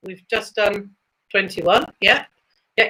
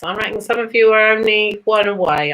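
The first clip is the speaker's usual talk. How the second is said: ordinary talk, nothing marked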